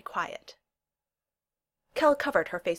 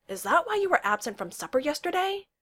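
higher-pitched shocked question; gasped; 9 semitones higher than usual. A narrator's reading. Seaver works out Kel's absence, gasping. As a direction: gasp it out as a sudden realisation. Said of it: "Seaver gasped".

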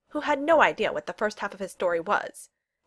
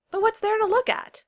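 high, loud teasing question; mock puzzlement; 5 dB louder than usual. Newcomer teases the girl, mock baffled. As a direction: ask it as if baffled.

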